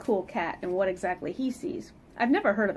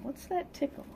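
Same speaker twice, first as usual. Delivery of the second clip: usual speaking voice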